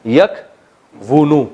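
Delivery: یکونو said very slowly with the w sound kept; slow teaching pronunciation of یکونو; slow drawn-out yakwunu. Shown as a demonstration. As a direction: stretch out یکونو, keeping the kw sound. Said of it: "ینصرو کی طرح".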